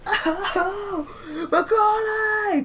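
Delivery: high, drawn-out tearful wail; mock shouting. Hurt kid shouting at his brother, mock tearful yell. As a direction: wail the name, stretched and mock-crying. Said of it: "Just, like, shouting at him".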